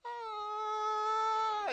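long high wail, slow motion; drawn-out high cry. Retold or imitated. Bloodied kid running to his brother, high drawn-out wail. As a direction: hold a high panicked scream. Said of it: "as I'm screaming".

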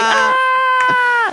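high cartoonish scream. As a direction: shriek like a cartoon. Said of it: "like, a cartoon".

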